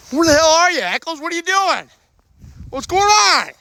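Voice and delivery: high, loud calling out; frantic shouting. Acted. Guide shouting for Eccles who stepped off the path. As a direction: yell for him, alarmed.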